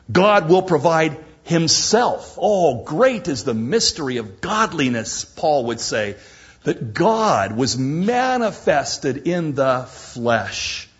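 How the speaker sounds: ordinary talk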